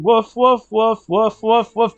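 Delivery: playful sung woofs; sung barking. Pretend. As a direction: bark it in the tune. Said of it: "There, I sung it".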